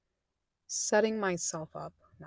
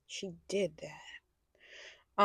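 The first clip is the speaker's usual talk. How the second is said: sassy aside; 4 dB quieter, much slower than usual. Acted. Sassy aside about herself in college.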